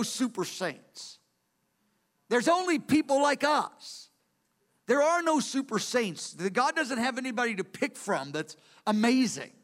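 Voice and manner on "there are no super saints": ordinary talk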